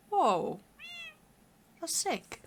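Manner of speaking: sudden loud gasp of wonder then a high squeaky compliment; delighted by a firefly in the game; excited whoa. Real, not acted. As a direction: marvel at something pretty you just spotted.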